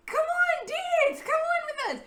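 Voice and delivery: high, loud, cheerful coaxing; high excited coaxing. Retold or imitated. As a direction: coax her onto the dance floor. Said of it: "was like".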